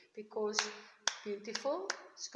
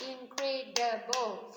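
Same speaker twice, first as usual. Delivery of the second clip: "incredible" slowly in four separate beats; syllable by syllable. Shown as a demonstration. Teacher counting syllables for the class, "incredible" broken syllable by syllable. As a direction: clap out "incredible", each syllable stressed alike. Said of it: "can you clap with me".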